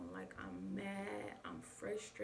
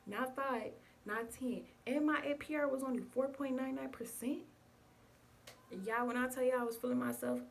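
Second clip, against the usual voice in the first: excited brag; 4 semitones higher, 6 dB louder than usual. Real, not acted. Bragging about the car deal.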